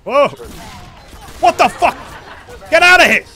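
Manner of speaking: very high, loud shout; panicked yell. Real, not acted. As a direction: yell at the attacker to leave. Enemy rushing in.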